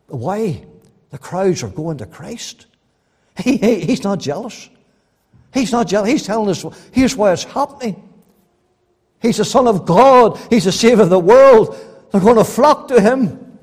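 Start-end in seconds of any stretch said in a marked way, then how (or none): 3.4-13.6 loud, ringing pulpit delivery; fervent sermon voice; full-force preaching. Acted. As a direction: preach it out to the whole hall.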